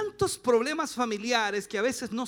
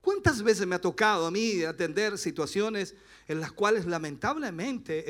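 usual speaking voice

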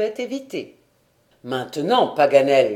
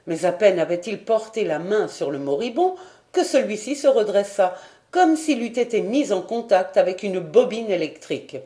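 usual speaking voice

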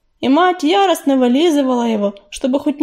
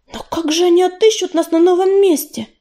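higher-pitched anxious question; worried; 6 semitones higher than usual. A narrator's reading. Листвичка worries the ancestors will not find them, anxious. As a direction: fret aloud, voice lifting. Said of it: "заволновалась Листвичка".

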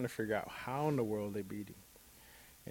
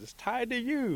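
high-pitched, louder objection; objecting Bear; 6 dB louder than usual. A narrator's reading. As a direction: point out the obvious problem in disbelief. Bear objects to Rabbit's plan.

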